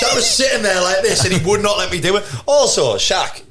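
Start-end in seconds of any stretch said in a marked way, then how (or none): 0.0-2.2 laughing. Real, not acted. Laughing recount.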